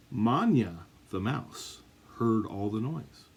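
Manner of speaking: ordinary delivery, nothing marked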